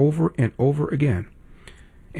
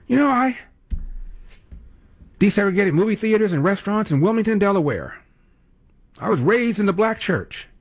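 higher, mocking Biden voice; mock Biden quote; 7 semitones higher than usual. Host mocking Biden's boasts to listeners, higher mock voice. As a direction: sneer his boast back in a higher voice.

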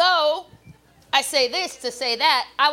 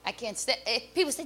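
usual speaking voice

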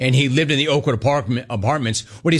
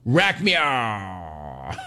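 going under anesthesia; 4 semitones lower, 4 dB quieter, much slower than usual. Pretend. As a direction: drop off mid-word. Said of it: "Count to ten".

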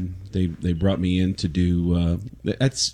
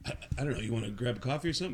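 nervous; 11 dB quieter, much faster than usual. Retold or imitated. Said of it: "I was so nervous".

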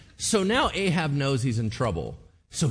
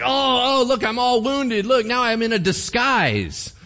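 high, loud hammed-up whine; mock wounded prophet; 9 semitones higher, 5 dB louder than usual. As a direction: play up the fake injury, pleased with yourself. Wounded prophet shows off his disguise.

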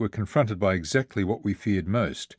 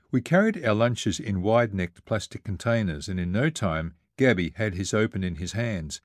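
ordinary delivery, nothing marked

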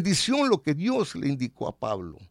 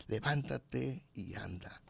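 whispered command; 7 semitones lower, 13 dB quieter, slower than usual. Shown as a demonstration. Repeated command now whispered.

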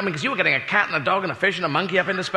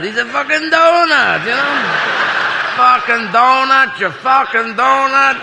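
higher, louder, slow mocking Berliner; German crowd voice; 7 semitones higher, 4 dB louder than usual. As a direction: sneer at the American as a donut. Acted Berliner crowd dialogue.

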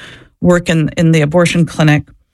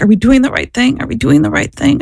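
higher, pleading question, repeated; retold tearful doubt; 7 semitones higher than usual. Crying nurse asking her knee to knee, retold anxious doubt. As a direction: imitate an upset colleague seeking reassurance. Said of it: "crying. And she's like".

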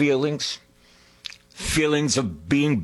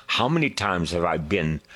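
normal talking voice

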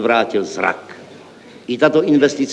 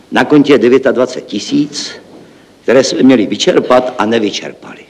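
ordinary talk, nothing marked

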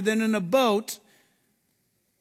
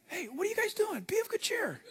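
high, hushed, breezy hail; light, soft, high-pitched greeting; casual soft call; 11 semitones higher, 8 dB quieter than usual. Acted. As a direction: call gently as if from a distance, trailing into a whisper. Jesus casually hailing the frightened disciples.

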